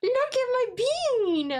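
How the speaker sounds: cooing pet voice. Real, not acted.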